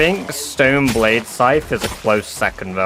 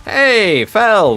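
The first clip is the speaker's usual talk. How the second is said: excited greeting; 7 semitones higher than usual. Real, not acted. Greeting a new viewer.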